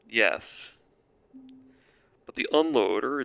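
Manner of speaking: usual speaking voice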